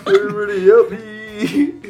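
loud, slow, laughing plea; mock plea for help. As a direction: beg everyone, hamming it up. Joking fake cries for help.

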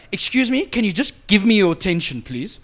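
mock her insisting on attention; demanding girlfriend. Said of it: "And she's like".

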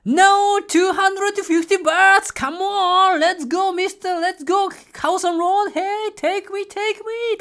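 overexcited tourist begging the tuk-tuk driver to go; wild hyped pleading. Retold or imitated. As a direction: cheer the driver on like a crazed tourist. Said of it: "本当にこのテンションで言った".

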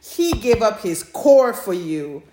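hammed-up outrage through a laugh; mock indignant, laughing. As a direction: tell him off with a laugh. Mock scolding a fictional character.

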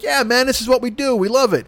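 high, content party bro; cheerful dude embracing it; happy partier. Retold or imitated. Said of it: "icebergers like".

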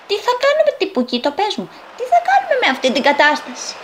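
high, agitated character voice; anxious pleading. Acted. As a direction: plead for an answer, pitched high. Worried friend pleads for answers. (αγωνιώδης παράκληση με ψιλή φωνή)

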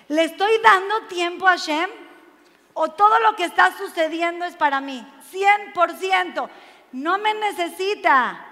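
loud, high-pitched preacher's challenge; impassioned preaching. Real, not acted. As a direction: hammer it home to the congregation. Preaching at full force. (prédica apasionada a plena voz)

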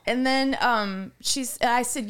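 normal talking voice

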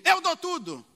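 showoff boasting. Retold or imitated. Churchgoer showing off to the congregation, loud boastful offer. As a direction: show off your generosity.

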